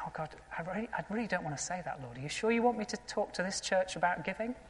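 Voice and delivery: soft, hesitant mock plea to God; quietly querying the Lord, as if retelling it; reluctant prayer. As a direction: retell a reluctant prayer under your breath. Reluctant private prayer retold.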